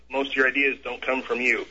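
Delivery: normal talking voice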